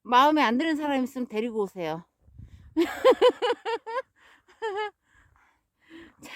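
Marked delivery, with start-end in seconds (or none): 2.8-4.0 a short high giggle after a dark joke; high laugh. Real, not acted. Joke about a cliff edge.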